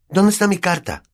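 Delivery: high-pitched, insistent question; demanding boy. A narrator's reading. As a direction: demand your letter back. Boy confronts uncle about letter.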